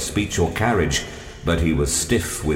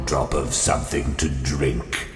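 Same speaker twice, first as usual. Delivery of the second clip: low looming threat; 4 semitones lower, 3 dB quieter than usual. A narrator's reading. Stranger cuts in low.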